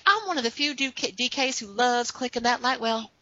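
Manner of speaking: imitating a friend's Southern accent; light Southern accent. Retelling the Twitter friend's line in a mild Southern accent. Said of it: "imagine her saying these things with this adorable Southern accent".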